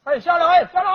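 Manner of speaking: loud, high shout to get off the bike; yelling at cyclist. Retold or imitated. Tricycle rider yells at the cyclist to get down, loud shout. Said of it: "有蹬三轮儿的冲我嚷".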